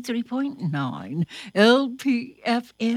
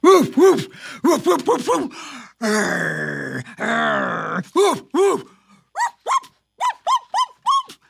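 high yappy woofs; playful dog barks; 4 semitones higher, 6 dB louder than usual. Acted. Reading to children, mock dog barking. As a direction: bark like a little dog.

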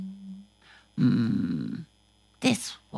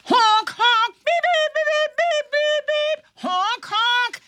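squeaky honk honk, beep beep; high car horn beeps; 5 dB louder than usual. Acted. Puppet show for kids, playful high traffic beeps. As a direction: toot like little cars, high and loud.

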